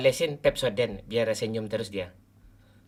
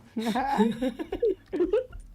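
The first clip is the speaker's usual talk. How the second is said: high laugh. Real, not acted. Laughing at silent friend.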